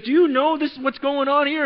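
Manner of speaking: high, loud indignant demand; furious challenge. Retold or imitated. Religious leaders challenging Jesus in the temple, indignant. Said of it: "They are furious".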